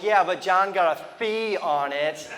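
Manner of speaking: usual speaking voice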